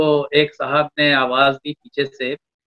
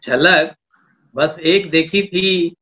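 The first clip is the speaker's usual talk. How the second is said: poetry recitation. Acted. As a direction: lean into each line of verse. Poet recites a couplet.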